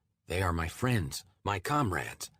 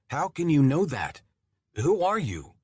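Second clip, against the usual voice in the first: alarmed; 7 semitones higher, 6 dB louder than usual. Acted. Shocked narrator confronts the voice.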